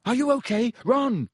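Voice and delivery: urgent high shouting; worried, high-pitched calling; shouted. A narrator's reading. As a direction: call through a wall to check on a friend. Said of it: "he shouted".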